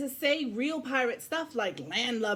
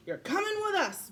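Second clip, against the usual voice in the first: high pirate voice; 10 semitones higher than usual. Acted. Pirate captain voiced in story.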